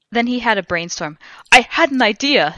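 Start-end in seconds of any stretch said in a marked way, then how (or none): 1.5-2.5 higher, louder sudden eureka; exclaiming. A narrator's reading. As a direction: exclaim it with mild excitement. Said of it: "he exclaimed".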